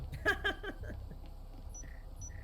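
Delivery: high laugh. Real, not acted. Laughing at blood joke.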